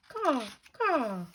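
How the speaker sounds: high, squawking caw caw; raven caw. Acted. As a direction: call out like a raven. Raven becomes a bird.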